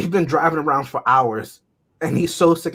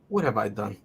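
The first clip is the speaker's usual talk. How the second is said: muttered regret; 6 semitones lower, 10 dB quieter than usual. Retold or imitated. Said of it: "So many times I've asked him".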